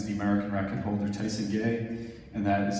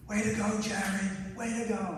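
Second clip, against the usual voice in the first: high-pitched cheering call from the crowd; dad shouting from the stands; 11 semitones higher than usual. Retold or imitated. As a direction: call out like a proud father cheering across the stadium. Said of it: "I could hear him shouting my name".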